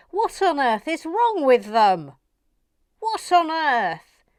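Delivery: hammed-up shock; mock outrage. Real, not acted. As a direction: exclaim in disbelief.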